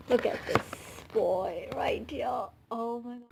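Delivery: hushed whisper of admiration. Real, not acted. Fan admiring her idol's photo, awed whisper. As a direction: breathe it out like a secret.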